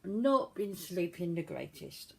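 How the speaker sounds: normal talking voice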